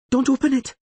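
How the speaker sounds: high, loud desperate scream. Acted. Ciri screams at the lawyer not to open the door. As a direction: shriek a warning in terror. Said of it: "Ciri screamed".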